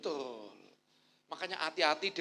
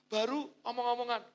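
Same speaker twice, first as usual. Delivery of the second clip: usual speaking voice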